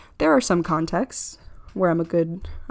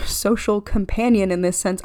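ordinary talk

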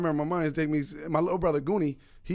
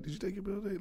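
higher, hushed teacher voice; imitated indiscreet whisper; 4 semitones higher, 12 dB quieter, faster than usual. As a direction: pretend to whisper in front of the class. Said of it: "trying to whisper in front of everybody".

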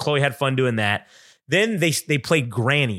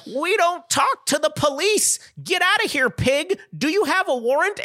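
much higher, slow cheeky taunting voice; mock defiant kid; 14 semitones higher than usual. Speaker joking to the bean sheriff, in a high cheeky taunting voice. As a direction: play a bratty kid sassing a cop.

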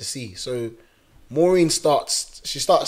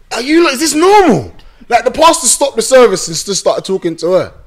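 high-pitched, loud disbelief; acted outraged astonishment; loud incredulity; 11 semitones higher, 11 dB louder than usual. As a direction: exclaim it, utterly baffled. Incredulous questioning of mum, retold.

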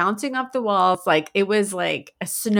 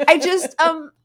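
lightly voiced little girl, higher; hesitant kid caught out, pitched up; sheepish child; 7 semitones higher, much slower than usual. Daughter answers mom about coughing, sheepish child. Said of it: "she's like".

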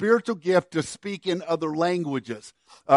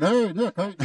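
higher-pitched hollow call through a tube; kids' tube call; 3 semitones higher than usual. Retold or imitated. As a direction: imitate kids hollering into a cardboard tube. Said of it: "my kids would do this".

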